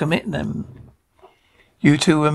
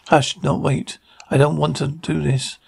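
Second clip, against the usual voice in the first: normal talking voice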